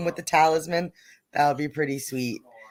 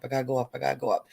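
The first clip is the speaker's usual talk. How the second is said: fast, lower self-talk to herself; rapid muttered repeat; 5 semitones lower, 6 dB quieter, much faster than usual. Real, not acted. Streamer scrolling her chat, rattles it off quickly. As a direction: rattle it off under your breath.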